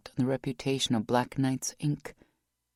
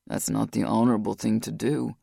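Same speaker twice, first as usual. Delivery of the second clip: lower, gently halting her; Rock, low and firm; 5 semitones lower than usual. A narrator's reading.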